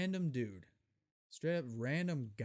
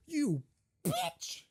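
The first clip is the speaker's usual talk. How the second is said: high, loud shouted insult; retold shout; 12 semitones higher, 5 dB louder than usual. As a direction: spit it at her high and loud. Retold yelling at lady.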